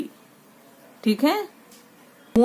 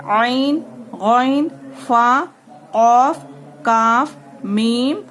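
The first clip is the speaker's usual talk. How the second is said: letter recitation; much slower than usual. Shown as a demonstration. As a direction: chant each letter for pupils to repeat. Teacher recites the letter chart.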